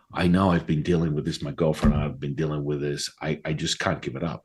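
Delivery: low voice fading away quietly; acted-out helpless admission, trailing into near silence; resigned confession. Teacher admits he cannot stop.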